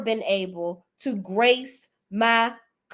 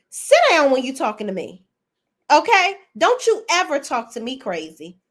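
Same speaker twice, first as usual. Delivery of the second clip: sharp, raised scolding voice; angry scolding; 7 semitones higher, 3 dB louder than usual. Pretend. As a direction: tell him off like a furious wife. Acted scolding of Greg.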